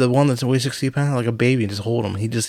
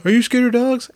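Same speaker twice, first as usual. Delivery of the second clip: higher, louder customer voice asking about dogs; 12 semitones higher, 5 dB louder than usual. Retold or imitated. Customer asks the delivery guy, voiced higher and louder. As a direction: play the worried customer, pitched up and loud. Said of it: "They go".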